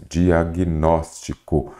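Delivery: "Diagnóstico" very slowly, syllable by syllable; separando em sílabas. Shown as a demonstration. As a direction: spell out the syllables of "Diagnóstico" at half pace. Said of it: "você já vem separando aqui". ("Diagnóstico" bem devagar, sílaba por sílaba)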